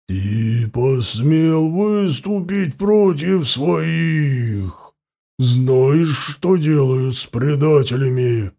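very slow, menacing beast voice; werewolf. Acted. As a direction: loom over him with a snarl. Werewolf threatens the boy.

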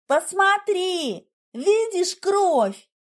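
high, drawn-out triumphant cry; exulting. A narrator's reading. Narrator shows Igor the blood, exultant. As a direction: crow over the find, stretching the words. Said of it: "Я ликовала".

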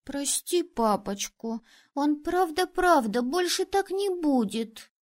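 sweet little daughter begging for her dad; little girl pleading. A narrator's reading. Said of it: "а Анита добавила".

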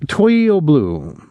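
slow, badly accented French. A narrator's reading. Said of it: "he had a bad accent".

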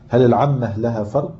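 ordinary talk, nothing marked

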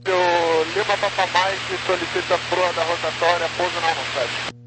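radio-filtered pilot voice; tinny transmission through the radio; police radio. Real, not acted. Pilot radio call to tower. (voz de rádio)

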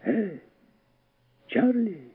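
put-on high, soft boyish call; high-pitched distant hail. A narrator's reading. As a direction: call like a boy from far away. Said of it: "снова услышал он знакомый голос".

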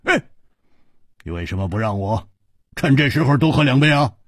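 hearty hero urging everyone to drink; Hu Tiehua, reckless bravado. Acted. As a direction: shrug off death and call for wine. Hu Tiehua pleading for wine.

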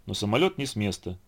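ordinary delivery

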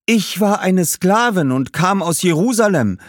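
high, loud woman's ghost voice; female slave ghost. A narrator's reading. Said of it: "meldete sich ein weiblicher Geist". (hoch und laut sprechender Frauengeist)

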